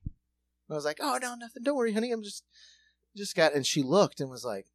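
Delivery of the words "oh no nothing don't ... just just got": put-on high, guilty placating to a child; sheepish excuse. Dad caught on the Switch, soothing his daughter sheepishly. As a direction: cover up quickly, sweet-talking.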